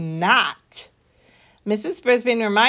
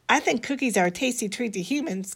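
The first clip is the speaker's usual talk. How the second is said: ordinary delivery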